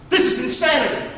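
ordinary delivery

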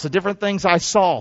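ordinary delivery

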